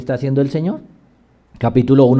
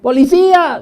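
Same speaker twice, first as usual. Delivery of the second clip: much higher, louder cry for help; shout for help; 12 semitones higher, 5 dB louder than usual. Retold or imitated. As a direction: yell for the police. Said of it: "para que no grite".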